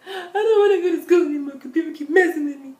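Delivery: high, loud crying wail broken by laughs; half-laughing mock sob through the words; hammed-up crying kid; retold bawling. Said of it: "I was bawling".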